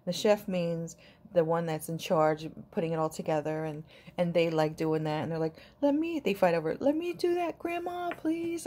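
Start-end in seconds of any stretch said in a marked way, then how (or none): 5.8-8.6 put-on high pleading child voice; mock child voice begging grandma; imitating grandkids. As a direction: beg like an eager little kid. Kids begging to do it.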